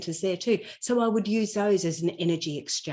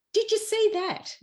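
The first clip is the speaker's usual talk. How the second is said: partner's question, pitched up; retold question; 12 semitones higher than usual. Partner in the car asking about a billboard, lightly voiced.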